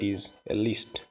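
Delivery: ordinary talk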